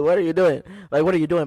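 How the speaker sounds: startled driver demanding what the other driver is doing, loud. Retold or imitated. Confronting the creeping driver.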